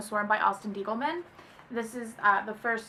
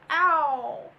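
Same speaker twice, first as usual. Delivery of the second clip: sudden loud yelp of pain; 5 semitones higher, 5 dB louder than usual. Real, not acted. Laughing so hard she hits herself, a yelp. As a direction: yelp as you smack your face.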